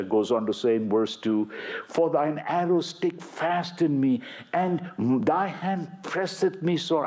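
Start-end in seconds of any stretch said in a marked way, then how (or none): 1.9-7.1 raised pitch, reading the psalm with fervour; lifted, pleading psalm voice; impassioned scripture reading. Acted. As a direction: read it like a sermon's prayer. Preacher reads psalm aloud.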